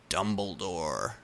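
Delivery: plain reading voice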